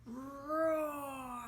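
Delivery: playful mock roar; a gentle pretend lion roar for kids. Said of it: "don't forget to roar".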